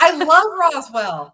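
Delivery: delighted, drawn-out outburst; excited gushing. Real, not acted. Book club guest cheering a favorite show, excited and gushing. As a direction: gush it high and loud, with a laugh.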